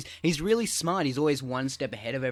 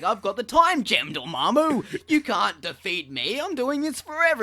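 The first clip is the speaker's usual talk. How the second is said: higher, slower taunting hero voice; mock smug hero; 8 semitones higher, 5 dB louder than usual. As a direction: taunt the villain, playfully. Voicing Strange taunting.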